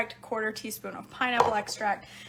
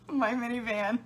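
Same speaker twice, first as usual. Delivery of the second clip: normal talking voice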